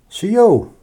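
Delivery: bright called greeting. Shown as a demonstration.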